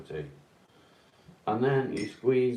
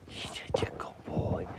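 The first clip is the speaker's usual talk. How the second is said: soft breathy praise, barely voiced; whispered praise; 2 semitones lower, 4 dB quieter than usual. Real, not acted. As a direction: whisper it to the dog. Whispering to the dog.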